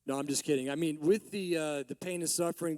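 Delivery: ordinary delivery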